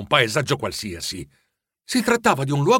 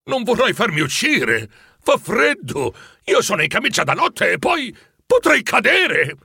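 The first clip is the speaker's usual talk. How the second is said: fretful protest; 8 semitones higher than usual. A narrator's reading. Scrooge fearful protest.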